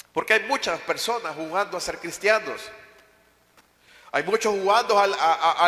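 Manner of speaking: usual speaking voice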